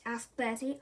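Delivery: ordinary delivery, nothing marked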